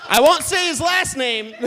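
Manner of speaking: loud, high, through laughter; loud laughing admission; laughing loudly. Real, not acted. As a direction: blurt it while cracking up. Laughing at own slip.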